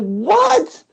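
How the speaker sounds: high, astonished what; mock shocked squeak; incredulous. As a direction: act out disbelief way up high. Retelling disbelief at the answer.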